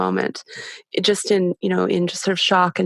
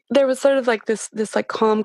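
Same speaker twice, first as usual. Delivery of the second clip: ordinary talk, nothing marked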